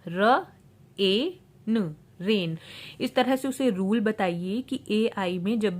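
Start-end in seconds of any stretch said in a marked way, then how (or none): 0.1-1.9 र, ए, न as isolated sounds; sounding out. Shown as a demonstration. Phonics teacher breaking a word down, sounding out र, ए, न. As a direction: separate र, long ए and न with pauses. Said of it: "ए का long sound होगा".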